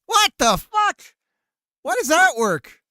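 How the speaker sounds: high, very loud disbelief; shocked outburst. Real, not acted. Streamer baffled that it works, shocked high shout.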